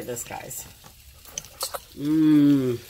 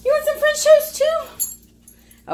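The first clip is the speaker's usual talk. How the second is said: high, louder sing-song to the dog; 7 dB louder, slower than usual. Real, not acted. Baby talk to the dog.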